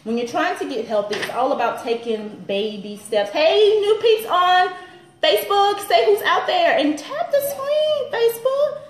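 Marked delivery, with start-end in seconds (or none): 3.3-8.8 high, slow, louder sing-song call to the crowd. Real, not acted. Host calling out to new Facebook viewers, high and drawn out. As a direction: hail the newcomers like across a room.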